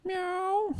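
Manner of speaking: very high imitated meow; high feline mew; cat's cry. A narrator's reading. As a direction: cry out like a cat. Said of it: "the cat cried".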